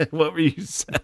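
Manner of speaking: ordinary delivery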